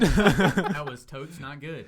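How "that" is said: slow and loud through laughter; laughing at his own failed pickup line. Real, not acted. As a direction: drag it out while cracking up. Laughing at own train wreck.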